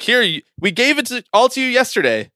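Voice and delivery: high, sarcastic voice of the schedule makers; mock the league handing it all over; sarcastic rant as the league. Mock schedule makers speaking, ranting.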